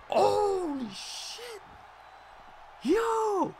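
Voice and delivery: very high, drawn-out cry; stunned disbelief. Real, not acted. Streamer stunned by a drop kick, high shocked cry. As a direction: gasp it out in shock.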